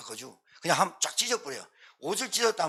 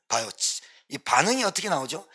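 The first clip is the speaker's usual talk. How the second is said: normal talking voice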